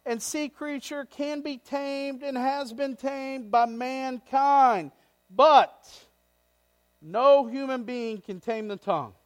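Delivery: loud, high, impassioned pulpit delivery; fiery preacher; full-force preaching. Acted. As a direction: preach it out to the back row.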